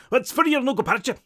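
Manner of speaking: high, loud, garbled Scots; thick Scottish accent. A narrator's reading. Barry commenting to the room, thick Scottish accent. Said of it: "Barry is Scottish".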